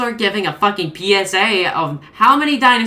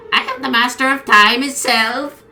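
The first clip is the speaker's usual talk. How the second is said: a mildly higher robotic announcement; computerized voice; 6 semitones higher than usual. Acted. Bob or June claiming mastery of time, robotic voice. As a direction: speak like a machine declaring power. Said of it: "in an obviously computerized voice".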